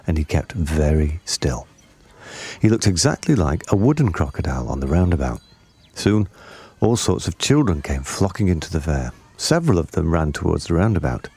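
usual speaking voice

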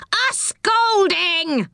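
very high, drawn-out squawking roar; angry pixie roar. A narrator's reading. Pixie echoes the threat from just behind, angry high roar. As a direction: roar it slowly at the top of the range. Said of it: "roared the angry pixie".

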